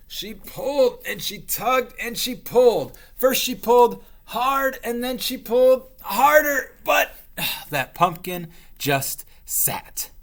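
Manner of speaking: high, loud effortful pulling; straining witch. Acted. Witch tugging at the stuck pumpkin, straining.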